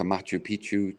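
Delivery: normal talking voice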